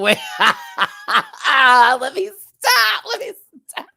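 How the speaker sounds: very high, drawn-out laughing squeal; helpless laughter. Real, not acted. Host teasing Viv, squealing with laughter.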